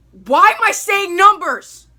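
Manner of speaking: high, loud, slowed exasperation; exasperated self-scold. Real, not acted. Vlogger annoyed at numbering honorable mentions again, exasperated. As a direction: groan at your own habit.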